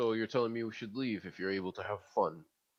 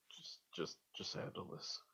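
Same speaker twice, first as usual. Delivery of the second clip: unvoiced whisper; whispered in the Whispering Tunnel; 3 semitones lower, 14 dB quieter than usual. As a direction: breathe it out with no voice at all. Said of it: "whispering in the tunnel".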